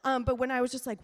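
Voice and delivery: ordinary talk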